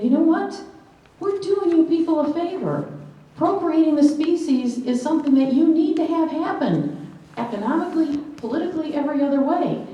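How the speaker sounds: high-pitched, emphatic declaration; acted-out indignant speech; assertive stand. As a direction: stand up and tell them off. Imagined defiant speech by women.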